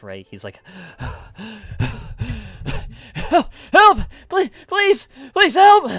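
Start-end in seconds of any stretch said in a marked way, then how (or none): 0.6-3.1 sharp panicked gasp for air; frantic gasp. Acted. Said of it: "He's out of breath". 3.1-5.9 high, loud panicked screams for help; terrified screaming. Acted. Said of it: "he, like, screams out".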